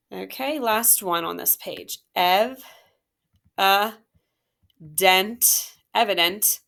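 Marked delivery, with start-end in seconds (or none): 2.2-5.7 "Ev, a, dent" each syllable said apart, louder, with pauses; split into syllables. Shown as a demonstration. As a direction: chop "Ev, a, dent" into separate beats. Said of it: "circle the suffix".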